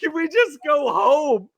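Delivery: high, wailing mock sobs; losing it. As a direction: burst into a hammed-up crying meltdown. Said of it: "all of a sudden I just lose it".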